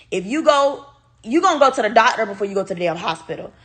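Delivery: ordinary talk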